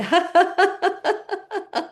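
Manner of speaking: high, rapid staccato fake laugh; fake laughter. Shown as a demonstration. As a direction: force out a high run of ha-ha laughter. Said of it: "slightly fake laughing sounds".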